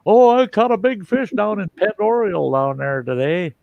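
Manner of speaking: high-pitched mock out-of-towner; non-local. Local mocking non-locals over a lake name, high put-on voice. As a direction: imitate a clueless visitor, pitched well up. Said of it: "you always knew the non-locals".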